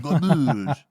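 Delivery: Garbage said the French way, mock French accent. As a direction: toss off Garbage like a joking Frenchman. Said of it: "I'll say it with a French accent".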